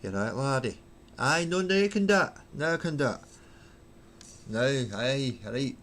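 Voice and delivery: trying out a Glaswegian accent; warming up into Glaswegian; Glasgow accent. Shown as a demonstration. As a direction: put on a Glaswegian voice. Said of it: "Do it in a Glasgow accent".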